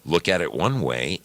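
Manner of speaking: ordinary delivery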